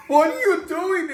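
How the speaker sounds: high, loud, drawn-out disbelief; exasperated mock yell. Drama teacher interrupting student's fake cry, exasperated yell. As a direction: holler it slowly as an appalled teacher.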